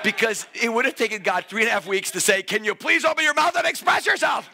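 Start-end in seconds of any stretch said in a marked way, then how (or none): 2.4-4.5 high-pitched comic begging; hammed-up pleading with a reserved crowd; mock exasperated plea. Acts out God pleading in joke.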